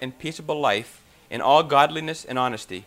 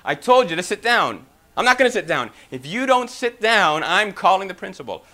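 loud, raised-pitch mock argument; acted classroom standoff; 4 semitones higher, 5 dB louder than usual. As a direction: trade stubborn orders and refusals. Acted standoff of teacher and boy.

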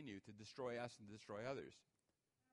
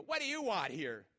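loud, rude shop clerk snapping; 4 semitones higher, 10 dB louder than usual. Retold or imitated. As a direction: bark it like an annoyed employee. Rude clerk voiced in story.

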